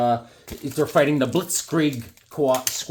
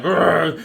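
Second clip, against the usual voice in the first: mock growl; 3 semitones higher, 5 dB louder than usual. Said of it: "they got all mad".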